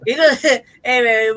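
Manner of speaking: laughing through the words, slow; words broken up by laughter. Real, not acted. Joking with the friend, laughing.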